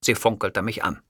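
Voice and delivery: usual speaking voice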